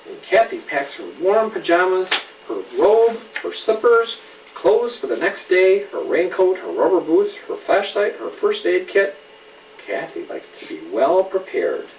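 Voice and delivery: put-on brighter, building list read to a child; lively picture-book reading; storybook list. A narrator's reading. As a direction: pile up each item with growing energy. Reads picture book aloud.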